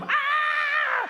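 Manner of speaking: high, loud yelp of a crash; preacher acting out his son falling in the dark; scream. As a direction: shriek suddenly at the fall.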